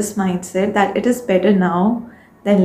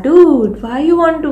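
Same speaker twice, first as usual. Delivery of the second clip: raised, higher voice, a mock scolding; higher and louder, like calling out to yourself; self pep talk; 6 semitones higher, 4 dB louder than usual. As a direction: snap yourself back to purpose. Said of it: "we have to, uh, sit and tell".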